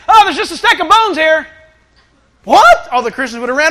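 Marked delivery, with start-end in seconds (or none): 0.1-1.5 mock yell. Imagined kid finding bones. 2.4-2.9 high, loud shocked yelp; shocked what. Acted. Preacher reacting to the bones in disbelief, shocked.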